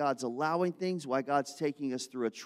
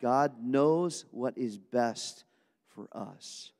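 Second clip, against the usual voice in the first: slow emphatic; much slower than usual. Shown as a demonstration. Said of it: "Everybody say amen to that".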